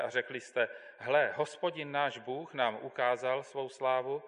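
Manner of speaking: measured, solemn Bible reading with long pauses; steady liturgical reading voice; scripture reading. Acted. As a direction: read the commandments as holy text, unhurried. Reads Deuteronomy aloud to congregation.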